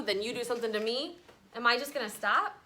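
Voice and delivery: ordinary delivery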